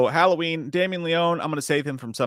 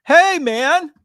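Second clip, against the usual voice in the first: calling out; 10 dB louder than usual. Real, not acted. Calling out to returning friend.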